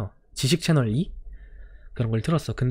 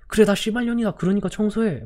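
higher, sharp angry comeback; furious retort; 8 semitones higher than usual. Retold or imitated. Teacher snapping back at the cursing student, furious. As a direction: throw it right back at him.

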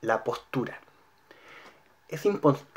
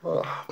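soft open-throated yawn; demonstrated yawn; 3 semitones higher, 6 dB quieter than usual. Shown as a demonstration. Voice teacher shows students how to yawn, demonstrated yawn. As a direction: let out a soft, wide yawn.

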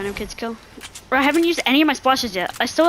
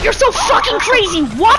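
excited yell; 10 semitones higher, 7 dB louder than usual. Real, not acted. Teammate wins wild endgame fight.

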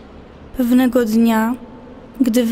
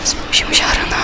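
breathy whispered line in a poem; hushed poetic whisper. Acted. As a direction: whisper it like a secret. Poem read with whispered lines.

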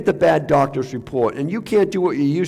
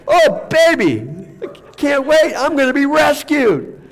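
much higher and louder, giddy with delight; gleeful Paul; 10 semitones higher, 4 dB louder than usual. Acted. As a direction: play Paul thrilled to die and go home. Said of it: "That's Paul".

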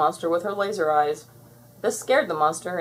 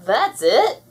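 loud, higher disbelief; incredulous cry; 5 semitones higher, 7 dB louder than usual. A narrator's reading. Buttercup seeing how small the monster is, incredulous. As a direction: cry out as if let down. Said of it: "cried Buttercup".

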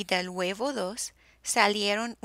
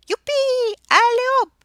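very loud, high cheer; whooping shout; 12 dB louder, faster than usual. A narrator's reading. Tyrannosaurs whoop at the fallen tree bridge, loud. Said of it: "Y los tiranosaurios gritaron".

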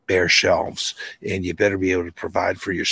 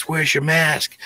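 high mock yell from a stranger; retold yell; 8 semitones higher, slower than usual. Stranger on the riverbank yelling at the kayaker, mock yell. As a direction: call it out across the water, mock-angry. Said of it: "a guy yells".

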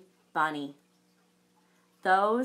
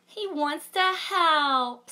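high, slow and fond; a bright cooing mother; sweet mama; 8 semitones higher, 5 dB louder, slower than usual. Acted. Mama defending the baby wolf, high and slow.

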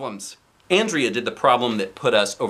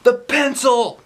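high, loud shouted answer; kids shouting; 11 semitones higher, 5 dB louder, slower than usual. Acted. Kids answering their teacher, shouting. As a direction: call it out eagerly. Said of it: "some of us shouted".

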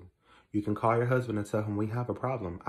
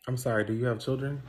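ordinary talk

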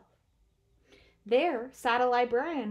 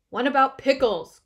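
loud, higher Bear order; commanding; 4 semitones higher, 12 dB louder than usual. A narrator's reading. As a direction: demand it firmly. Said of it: "commanded Bear".

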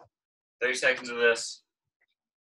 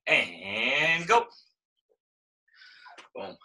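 normal talking voice

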